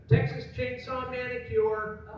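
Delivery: put-on sugar-crazed kid blurting a title, grinning; cartoonish announcement. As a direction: stretch it out like a cartoon tagline. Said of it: "he went".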